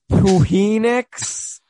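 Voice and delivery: Puhinix, said high, slow and loud, sounded out wrongly; hammed-up confused Russian reading; mock Russian accent. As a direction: butcher Phoenix as a bewildered Russian. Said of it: "they're like".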